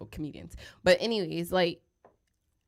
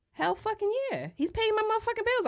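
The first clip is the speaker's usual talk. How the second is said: excited. Real, not acted. Emphatic outburst on topic.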